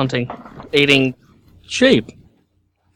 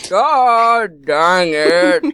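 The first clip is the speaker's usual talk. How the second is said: put-on grumpy old man; much slower than usual.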